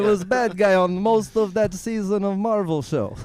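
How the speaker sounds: mock Russian accent, dropped articles; lightly played Russian villain accent. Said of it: "He's doing this accent".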